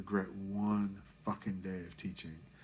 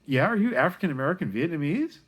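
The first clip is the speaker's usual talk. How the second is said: retold reply; 8 semitones higher, 8 dB louder than usual. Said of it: "I'm like".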